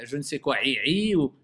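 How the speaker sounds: ordinary talk, nothing marked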